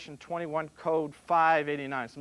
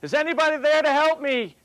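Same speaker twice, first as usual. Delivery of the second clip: high, loud call into empty air; yelling for help; 10 semitones higher, 7 dB louder than usual. Retold or imitated. The man in the joke hanging from a stick yells for rescue. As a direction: shout up for help, voice pitched high. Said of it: "he's yelling out".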